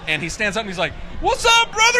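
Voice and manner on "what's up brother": excited greeting. Retold or imitated. Rock star greeting at signing.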